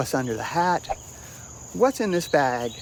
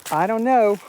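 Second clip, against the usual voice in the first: high laugh; 8 semitones higher than usual. Real, not acted. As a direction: burst out laughing. Laughs at taking beers.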